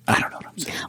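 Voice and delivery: soft unvoiced aside; self-deprecating mutter. Real, not acted. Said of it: "rambling again".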